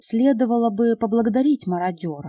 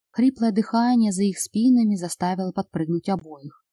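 plain reading voice

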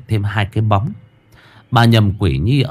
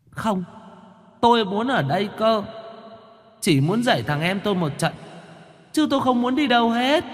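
high, soft stubborn refusal; a little ghost refusing to leave home; sulky ghost child; 13 semitones higher, 6 dB quieter than usual. Acted.

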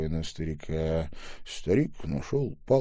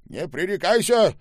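put-on scolding old man; 10 semitones higher, 7 dB louder than usual. A narrator's reading. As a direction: scold the boy.

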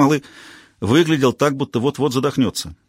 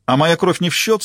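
raised, higher indignant outburst; indignant protest; 6 semitones higher than usual. A narrator's reading. Defendant protesting to the court, indignant. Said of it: "снова возмутился Ролло".

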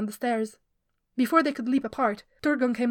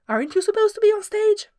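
louder, higher mock scold; retold scolding; 10 semitones higher, 6 dB louder than usual. Turgon repeats his own rooftop scolding, loud and high. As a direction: bark the question as he did on the roof.

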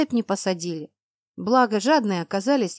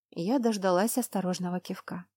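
plain reading voice

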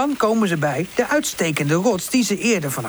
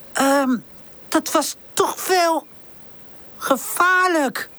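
shaken; 10 semitones higher, slower than usual. Acted. Pip shaken after landing.

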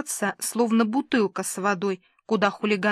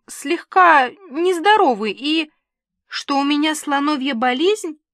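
despairing; 6 semitones higher, 5 dB louder than usual. A narrator's reading. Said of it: "С отчаянием в голосе".

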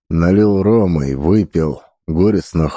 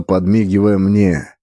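ordinary delivery, nothing marked

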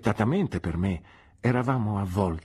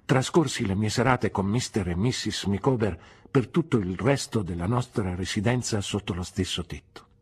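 plain reading voice

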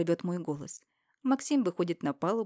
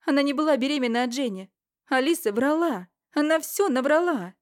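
higher, louder, fast female voice; Irina's urgent revelation; 8 semitones higher, 8 dB louder than usual. Acted. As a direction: blurt out the truth. Ирина раскрывает правду. (взволнованная Ирина, выше и громче)

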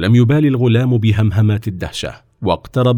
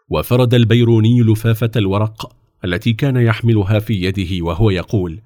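ordinary delivery

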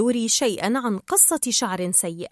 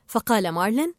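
plain reading voice

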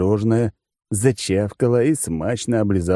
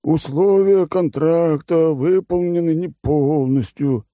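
high, slow, lazy condescending voice; bored rich glutton explaining terms; smug fat oligarch; 6 semitones higher, slower than usual. Acted. Oligarch's voice continues.

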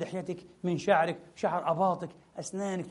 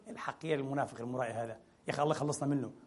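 lower, quieter sneering aside; hypocrite's inner voice; 5 semitones lower, 10 dB quieter, faster than usual. Retold or imitated. Preacher voicing a two-faced man's secret scorn, low sneer. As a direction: mutter the hidden contempt under the breath. Heart's hidden thought voiced mockingly.

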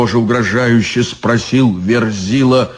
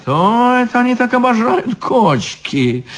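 surprised; 10 semitones higher than usual. Acted. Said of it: "удивился изящный чёрт".

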